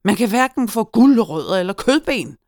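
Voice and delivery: loud, high complaint; enraged shout. A narrator's reading. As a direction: holler the grievance in a rage. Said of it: "råbte han, rød i hovedet af raseri".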